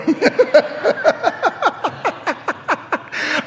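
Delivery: long high burst of laughter; real high laugh. Real, not acted. Pastor laughing at his lost bragging rights, real laugh. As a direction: crack up at yourself.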